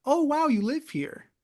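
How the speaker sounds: high, slow mock wonder; drawn-out, patronizing fake awe; condescending amazement. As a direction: imitate someone marvelling down at a simpleton. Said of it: "acting like I'm kinda, like, mentally challenged".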